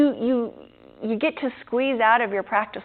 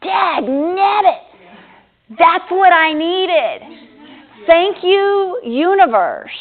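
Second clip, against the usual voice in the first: loud, slow, high outburst of comic frustration; hammed-up dag nabbit, then loud grateful thanks; mock exasperated delight; 8 semitones higher, 7 dB louder, much slower than usual. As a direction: throw up your hands and cry out to the universe. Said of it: "and I'm like".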